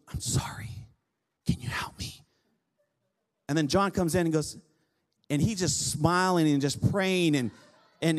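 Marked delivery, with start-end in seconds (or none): 0.1-2.1 retold plea in a soft whisper. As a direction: whisper it as someone asking for help.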